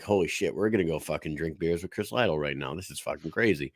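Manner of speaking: hushed, low retelling of excited whispers; low, soft aside, rushing in the middle; retold whisper. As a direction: quietly imitate whispering between starstruck friends. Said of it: "whispered to each other".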